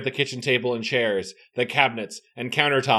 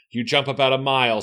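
ordinary talk

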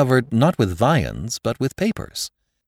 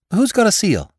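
put-on lively young man voice; 13 semitones higher, 5 dB louder than usual. Nephew looks about table.

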